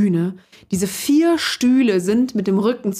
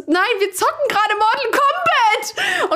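baffled explanation; slower than usual. Retold or imitated. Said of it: "Ich so".

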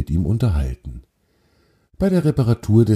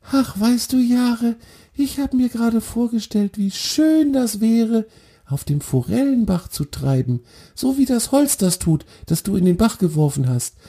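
very high little deer voice, wistful; dreamy little deer. Acted. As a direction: sing it up high, full of longing. Deer tells his dream.

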